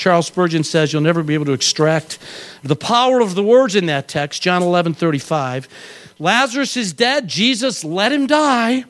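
normal talking voice

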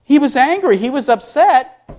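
ordinary talk

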